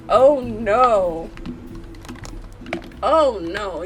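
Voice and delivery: ordinary talk, nothing marked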